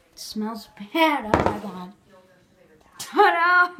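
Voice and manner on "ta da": loud, high triumphant reveal; excited ta-da. Real, not acted. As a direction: present it with a flourish. Unveiling the microwave result.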